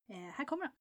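usual speaking voice

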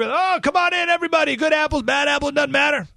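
high-pitched, sing-out open invitation; mock careless welcome. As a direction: wave everyone in with exaggerated naivety. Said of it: "they're, they're right now".

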